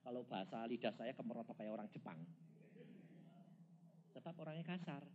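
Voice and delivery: ordinary talk, nothing marked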